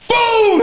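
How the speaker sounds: high, loud exploding boom; sudden cartoon explosion noise; loud mock boom. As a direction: blurt the boom like a blast. Imitating disposal blast.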